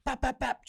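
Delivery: high, light pop-pop-pop gunfire noises; playful imitation of shots; mock gunfire. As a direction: mimic a rifle going off. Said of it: "He's like this".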